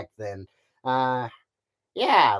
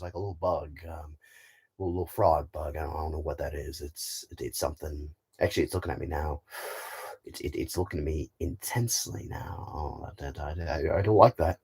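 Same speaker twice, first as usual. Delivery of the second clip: low, hushed, uneasy report; Buzz Lightyear; 8 semitones lower, 6 dB quieter than usual. Acted. Space ranger notices a bug staring back, low uneasy role-play. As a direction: lower and softer, as if watched by something. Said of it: "he's gonna be a great Buzz Lightyear".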